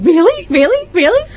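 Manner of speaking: the bride-to-be can't believe it; excited disbelief. Retold or imitated. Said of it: "I just kept saying".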